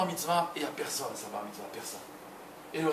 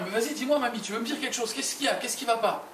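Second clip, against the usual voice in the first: put-on anxious little boy; 8 semitones higher than usual. Boy begs grandmother to speak.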